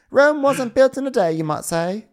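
high, loud mock-mum wisdom; put-on mother voice. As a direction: offer a proverb in a squeaky mum voice. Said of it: "And she's like".

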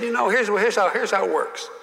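a hint of the old senator explaining; mock Russell Long. Senator explaining wells to the young colleague, lightly mimicked. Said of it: "And he said".

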